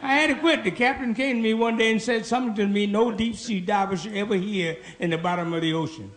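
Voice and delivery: ordinary talk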